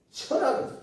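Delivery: ordinary talk, nothing marked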